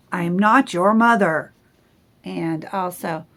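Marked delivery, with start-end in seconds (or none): 0.1-1.5 loud, stern grumpy bear; grumpy bear telling goslings off, loud and stern. Acted. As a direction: shoo them off sternly. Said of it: "Bruce was very stern".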